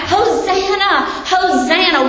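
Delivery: high, slow preacher's cry of a hopeful crowd. Retold or imitated. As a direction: call out like the crowd hailing its king. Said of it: "And they're crying out".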